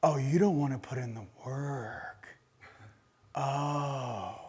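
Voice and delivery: low, soft, scornful aside; mock disdain. Speaker mocks a lazy alien species, low scornful murmur. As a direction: sneer it under your breath. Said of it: "I'm going".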